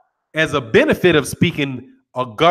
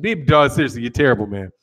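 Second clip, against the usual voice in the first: ordinary talk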